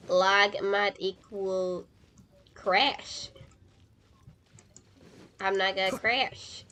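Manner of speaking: ordinary talk, nothing marked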